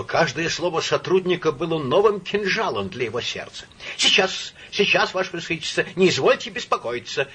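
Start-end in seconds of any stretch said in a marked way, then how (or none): 4.0-7.3 high, hurried reassurance; urgent, raised-voice compliance; energetic exclamation. A narrator's reading. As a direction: cry out briskly, eager to help. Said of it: "энергически вскрикнул Псилдонимов".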